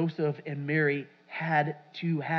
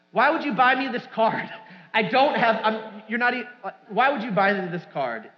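higher and louder, mock exasperated; retold mad outburst; 5 semitones higher, 5 dB louder than usual. Husband to wife over the surprise card, mock angry. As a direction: replay the fuming complaint, half-hammed. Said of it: "I'm like".

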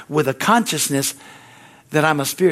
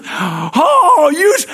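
crew's mock outcry; 12 semitones higher, 6 dB louder, much slower than usual. Crew jumps up at curse word.

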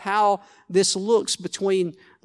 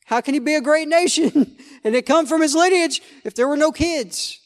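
full-force preaching; 7 semitones higher than usual. Acted. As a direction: lift the whole room with the problem.

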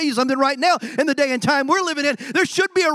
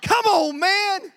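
road rage outburst; 7 semitones higher, much slower than usual. Retold or imitated. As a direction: yell at the driver who cut you off. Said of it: "and you're like".

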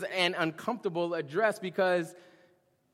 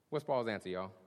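normal talking voice

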